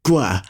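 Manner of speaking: "gwa" with the g held before a rounded w; lengthened gw. Shown as a demonstration. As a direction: hold the g closure, then release "gwa". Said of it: "pronounced as a lengthened G W sound".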